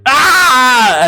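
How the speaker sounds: high, loud scream of pain; piercing scream. Acted. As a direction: shriek as the bone snaps. Captive screams as bone snaps.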